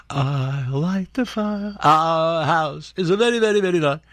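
mock Trump. Host mocking the president's boasting, slow and high. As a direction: drag out the brag like a smug president.

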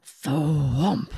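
loud, low drawn-out thwomp; imitated thud. A narrator's reading. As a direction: drop the voice like a crash landing. Imitated crash landing.

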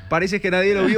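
high, laughing mock yell from afar; mock shout. Onlookers teasing the fallen dancer, mock shout. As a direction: call out teasingly across the room, half laughing. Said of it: "le gritan".

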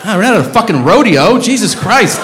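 high, exasperated yelling; aggressive outburst. Real, not acted. Comedian telling a whistling audience member to stop, aggressive. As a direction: blow up at the whistler, pitch climbing. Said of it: "that was a little aggressive".

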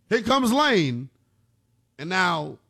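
ordinary talk, nothing marked

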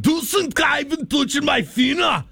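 radio host playing a German whale, shouting high in a hammy German accent. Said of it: "a whale off of German waters".

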